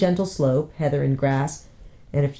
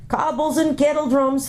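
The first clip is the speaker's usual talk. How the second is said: much higher, exasperated dwarf oath; dwarf's oath; 10 semitones higher than usual. Acted. Dwarf grumbling to himself on the cliff edge, exasperated. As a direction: mutter a dwarf's curse. Trumpkin's exclamation.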